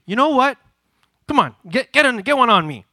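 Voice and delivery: acted-out insistent dare; urging. Acts urging brother to hit back.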